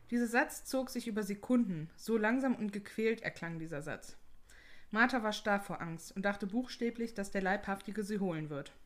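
measured read-aloud storytelling; story narration. A narrator's reading. Reads listener ghost stories aloud.

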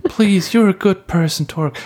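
high, pleading voice from above; wife's voice from heaven. Retold or imitated. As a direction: plead gently like an angel. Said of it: "die Stimme von deiner Frau, aus dem Himmel".

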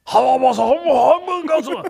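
hammed-up broken deepfake; glitchy deepfake. Fake boss glitches in scene.

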